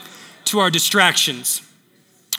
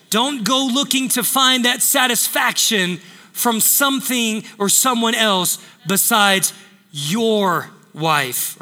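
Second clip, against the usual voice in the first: ordinary talk, nothing marked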